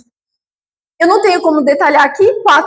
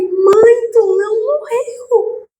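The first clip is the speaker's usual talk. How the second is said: stunned disbelief; 6 semitones higher, much slower than usual. Retold or imitated. Daughter seeing her dead mother alive, slow and hushed in disbelief. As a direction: breathe it out slowly, unable to believe it.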